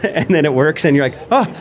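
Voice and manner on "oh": high, delighted relief; mock joy. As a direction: squeal with relief that it works. Retold relief at phone working.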